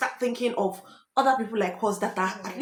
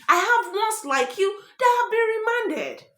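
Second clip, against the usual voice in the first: mock warning; 6 semitones higher than usual. Host imitates the woman's warning.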